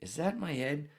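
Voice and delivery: high, soft, bewildered self-talk; lightly voiced half-asleep confusion; groggy inner voice. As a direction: wonder aloud if it's your own head pounding. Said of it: "I'm like".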